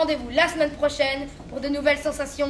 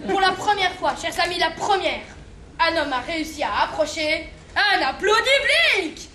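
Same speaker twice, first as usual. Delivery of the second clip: normal talking voice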